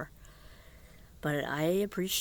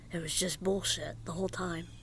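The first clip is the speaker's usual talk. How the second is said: ordinary talk, nothing marked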